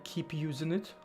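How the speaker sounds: ordinary talk, nothing marked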